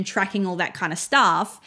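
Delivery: ordinary delivery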